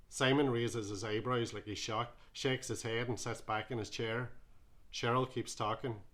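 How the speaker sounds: plain reading voice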